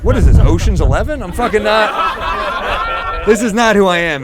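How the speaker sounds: loud outraged refusal; indignant protest. Retold or imitated. Guy refusing a stranger's strange offer, loud and indignant. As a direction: protest like you are offended. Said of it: "And I was like".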